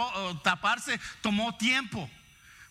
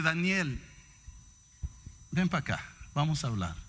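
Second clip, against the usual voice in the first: lower, softer, kind invitation; gentle invitation; 4 semitones lower, 3 dB quieter than usual. Retold or imitated. God gently calling Daniel to talk, soft and kind. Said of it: "algo completamente diferente".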